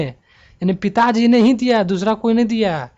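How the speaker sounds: loud, high, insistent stress on पिताजी ने ही; emphatic sentence stress. Shown as a demonstration. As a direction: hammer पिताजी ने ही as the only one. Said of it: "यहाँ पे वाक्य पर बलाघात".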